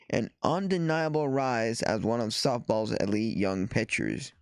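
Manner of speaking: ordinary talk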